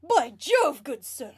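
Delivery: plummy British gent; overly British accent. Acted. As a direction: ham up a posh British gentleman. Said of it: "I love the overly British accents".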